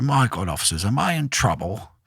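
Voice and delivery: lightly done posh English gent, surprised; a hint of plummy upper-class English; mild imitation of a posh English gentleman caught out; plummy posh accent. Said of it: "in the most plumiest accent".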